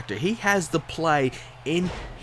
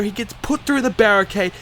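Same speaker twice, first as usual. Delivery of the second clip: higher play-by-play winding down; wrestling commentator; 5 semitones higher than usual. Acted. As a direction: call the finish, then land it slowly. Finish commentary.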